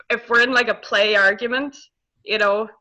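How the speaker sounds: normal talking voice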